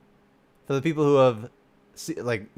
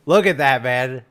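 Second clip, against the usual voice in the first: louder, awed exclamation; excited look at that; 7 dB louder than usual. Real, not acted. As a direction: marvel out loud at the picture. Reacting to redesign art.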